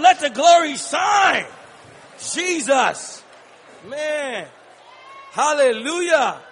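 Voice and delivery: ordinary delivery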